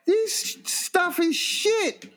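very high, drawn-out mock woman's whine; slow high-pitched complaint in a put-on voice; complaining woman. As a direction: caricature an outraged female customer. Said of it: "the woman is just like".

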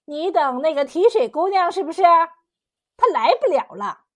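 put-on high, needling old woman; snide, ambiguous. A narrator's reading. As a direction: needle him with sly insinuation. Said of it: "就不阴不阳地说".

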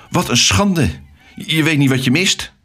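high-pitched indignant retort; angry. A narrator's reading. Alex tells off the unwelcoming cockatoos, angry. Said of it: "Alex werd boos".

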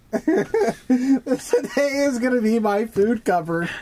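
slow, laughing through the words; giggling while struggling to speak. Real, not acted. Hiccuping, breaking into laughter.

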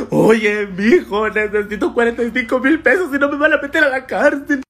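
loud, high fake sobbing plea for money; mock crying extortionist. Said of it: "llorando acá". (imitación de un extorsionador llorando, aguda y fuerte)

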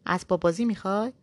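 a touch of surprised mockery; lightly startled, teasing question. Man mildly surprised, teasing the bored girl. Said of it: "با تعجب گفت".

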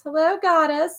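very high-pitched mock goddess reply; squeaky-high imitation of a comic character; imitating Albie's goddess. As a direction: mimic the friend's goddess bit way up high. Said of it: "And he goes".

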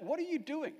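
ordinary talk

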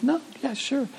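small, high, very soft voice; tiny high-pitched quoted answer; waitress's timid reply. Retold or imitated.

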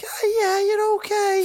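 put-on very high baby-talk voice. Shown as a demonstration. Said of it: "go like".

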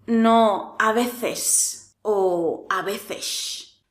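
veces with a slow, overdone hissing S; exaggerated S. Shown as a demonstration. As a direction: hiss the S of veces, slowly and too hard. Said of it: "No intentes marcarlo en exceso". (veces con ese exagerada y lenta)

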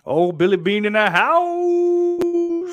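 put-on slow, very high Scottish accent; hammed-up Scottish accent shout. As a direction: announce it in a big Scottish accent. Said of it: "That was a legit great Scottish accent".

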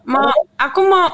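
ordinary talk, nothing marked

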